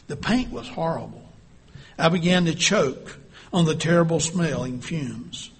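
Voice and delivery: ordinary delivery, nothing marked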